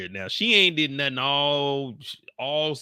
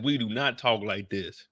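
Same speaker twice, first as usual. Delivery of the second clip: ordinary talk, nothing marked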